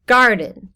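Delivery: "Garden" with GAR loud and the second syllable dropped; stress on first syllable. Shown as a demonstration. Teacher illustrates a trochee, first-syllable stress. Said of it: "the first syllable is said more loudly".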